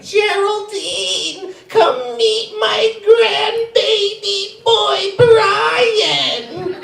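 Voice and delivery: high, drawn-out granny voice; grandma voice. Retold or imitated. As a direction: call out proudly like a granny. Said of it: "my grandma would be like".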